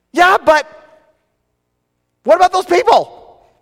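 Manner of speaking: high, loud pleading whine; whiny protest. Retold or imitated. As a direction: whine like a kid caught out. Arguing back with God.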